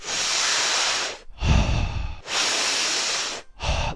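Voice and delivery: long, deep, audible exhale; deep breath. Shown as a demonstration. Demonstrating deep breaths under a choke, long exhale. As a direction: breathe out as if your life depends on it. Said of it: "like my life depended on it".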